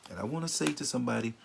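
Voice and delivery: usual speaking voice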